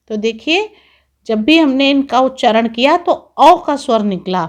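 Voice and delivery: normal talking voice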